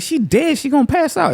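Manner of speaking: high mock-frantic cry; high panicky voice. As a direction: squeak it out in mock alarm. Retold panicked reply.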